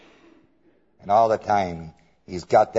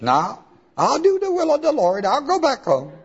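much higher, whiny promise; mock repentant Balaam; 13 semitones higher than usual. Balaam promising the angel to go home, higher. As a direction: squeak out the promise to obey.

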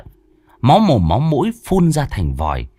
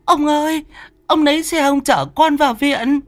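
panicked wailing mother. Acted. Mother clutching her collapsed son, frantic high wail. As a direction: shriek for help over your stricken son.

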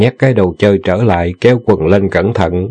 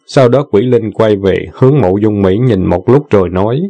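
plain reading voice

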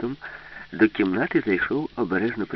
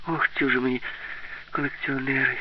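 higher-pitched, mildly exasperated sigh; 6 semitones higher than usual. A narrator's reading. Alice despairing of collectors, understated sighing voice. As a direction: let the words out as a light sigh. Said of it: "зітхнула Аліса".

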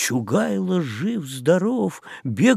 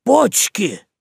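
shout; 6 semitones higher, 3 dB louder than usual. A narrator's reading. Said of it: "кацман кричит".

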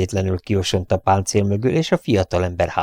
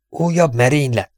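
higher, alarmed exclamation; startled outcry; 6 semitones higher than usual. A narrator's reading. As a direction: burst out at the sight of the attack. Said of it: "hördült fel Sullivan".

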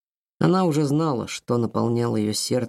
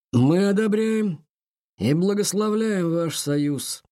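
slow, high booming elder; 6 semitones higher, slower than usual. A narrator's reading. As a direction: proclaim it solemnly and slowly. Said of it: "громогласно ответил Нил".

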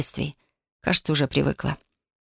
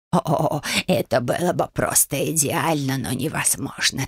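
low, slow croaking hag; old witch voice; 3 semitones lower, slower than usual. A narrator's reading. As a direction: croak it like a greedy crone.

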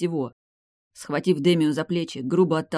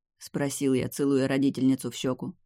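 usual speaking voice

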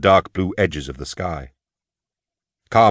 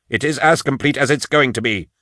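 higher-pitched irritated snap; snapped retort; 5 semitones higher than usual. A narrator's reading. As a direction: cut off the objection testily. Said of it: "he snapped".

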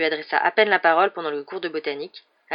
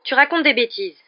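higher, louder retort; irritated raised voice; annoyed; 6 semitones higher, 5 dB louder than usual. A narrator's reading. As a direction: snap back in irritation. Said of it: "répliqua Hermione agacée".

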